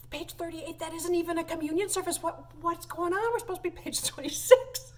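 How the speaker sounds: high-pitched, mock-baffled protest; retold bewilderment. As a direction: retell a flustered aside, pitch well up. Retold alarm at wrong page.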